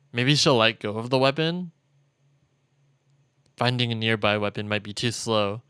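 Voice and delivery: ordinary delivery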